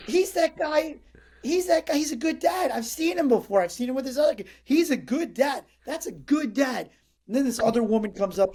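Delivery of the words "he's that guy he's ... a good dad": admiring stranger, much higher; admiring couple. Retold or imitated. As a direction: gush like an impressed onlooker. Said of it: "this other couple's like".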